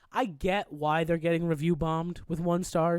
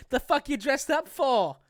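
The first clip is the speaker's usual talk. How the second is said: put-on hammy, higher Boston accent; bad Boston accent; 8 semitones higher than usual. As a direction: fake a thick Boston accent. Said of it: "That was a bad Boston accent".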